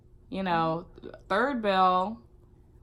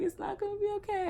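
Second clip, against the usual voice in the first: high, soft, quick sobbing protest; retold crying answer to the comforting teacher; 4 dB quieter, faster than usual. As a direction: cry it out high and fast.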